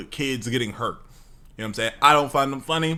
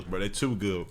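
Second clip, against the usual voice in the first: ordinary delivery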